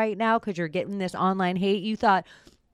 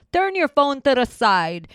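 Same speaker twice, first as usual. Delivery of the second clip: mock catchphrase; 6 semitones higher, 5 dB louder than usual. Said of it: "quoting Schultz catchphrase".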